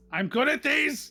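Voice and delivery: high, loud eager boast; excited boast. Real, not acted. Player thrilled by a puzzle room, eager shout. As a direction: burst out proud and eager.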